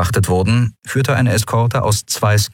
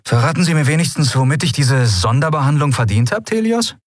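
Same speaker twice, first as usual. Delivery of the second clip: higher, fast needling question; Sephiron, mocking; 8 semitones higher than usual. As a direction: poke at the admiral with a rising jab.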